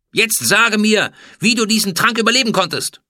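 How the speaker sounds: high, loud imperious demand; stern interrogation. A narrator's reading. Pharaoh interrogates the food taster about surviving, angry demand.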